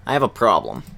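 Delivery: ordinary talk, nothing marked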